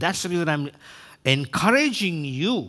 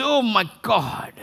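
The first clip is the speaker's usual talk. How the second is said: high, drawn-out mock dismay; exclaimed curse word; 5 semitones higher, 4 dB louder than usual. Preacher mimics people misusing God's name, mock dismayed exclamation. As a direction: say it as an oath, slowly, pitched up. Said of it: "like a curse word there".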